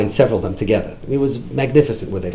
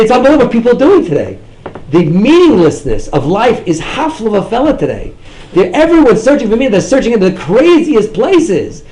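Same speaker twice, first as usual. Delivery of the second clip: louder, higher sermon drive; fervent preaching; 6 semitones higher, 7 dB louder than usual. Acted. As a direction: preach it with rising passion. Rising preaching.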